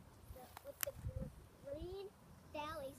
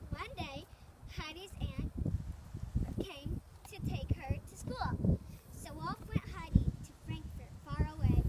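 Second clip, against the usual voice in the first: loud careful reading; 9 dB louder than usual. Real, not acted. Child reading aloud loudly.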